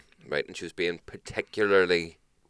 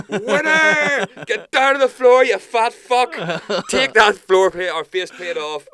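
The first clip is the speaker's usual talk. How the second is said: much higher, loud gloating villain voice; mock triumphant shout; 13 semitones higher, 9 dB louder, slower than usual. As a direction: crow over her like a cartoon villain. Gloating over the customer's surrender.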